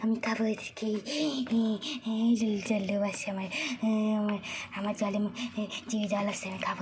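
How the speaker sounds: low, hungry possessed-woman voice; possessed, hungry Rani. Acted. Possessed mother drooling over the children, low hungry growl. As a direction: growl it low, savouring the prey.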